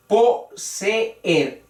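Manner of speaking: "Poseer" split po-se-er; po, se, er, each syllable separate; broken hiatus. Shown as a demonstration. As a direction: break "Poseer" between the two e's, three beats. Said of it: "ahí vemos la ruptura".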